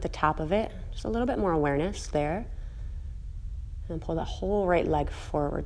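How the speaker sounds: soft, unhurried cool-down guidance; calm yoga voice. Acted. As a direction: ease the class down gently, softly.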